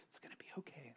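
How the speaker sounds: whispered reassurance, very soft; wife's soft whispered comfort; soothing whisper. Retold or imitated. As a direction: hush him so only he hears. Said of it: "And she was like".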